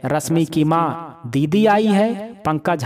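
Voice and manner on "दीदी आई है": higher, slow, amazed echo; mother echoing the news in surprise; surprised question. A narrator's reading. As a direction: she can't believe her daughter came. Mother surprised at daughter's arrival.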